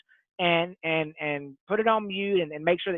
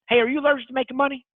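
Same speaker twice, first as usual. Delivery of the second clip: fast, bright, higher pitch; quick louder higher pitched pitch line; James's punchy delivery; 7 semitones higher, 4 dB louder, faster than usual. Retold or imitated. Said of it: "he just has this delivery of how he does it".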